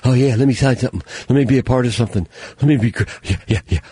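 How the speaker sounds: put-on eager joiner voice; mocking petition signers. Host imitating petition signers behind computers, mock eagerness. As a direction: gush sarcastically, tumbling over the words.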